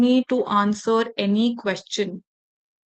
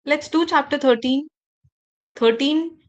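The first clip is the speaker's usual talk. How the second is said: THIRTEEN raised like a question; higher pitch, rising on THIRTEEN; upward intonation; 4 semitones higher than usual. Shown as a demonstration. Teacher demonstrating to trainees an unsure instruction, upward intonation. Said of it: "my voice is going up".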